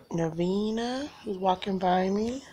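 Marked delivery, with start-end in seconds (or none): none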